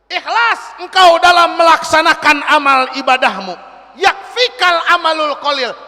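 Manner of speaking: loud, forceful preaching; preaching shout. Real, not acted. Preacher calling out to the hall, loud and forceful. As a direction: proclaim it to the congregation.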